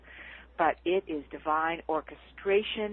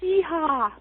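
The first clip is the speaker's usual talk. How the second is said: yeehaw cheer; 9 semitones higher, 6 dB louder than usual. Real, not acted. Excited cheer at being allowed.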